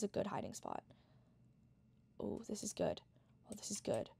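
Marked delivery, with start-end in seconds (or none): 2.2-4.1 whispering. Real, not acted. Hiding, whispering to self.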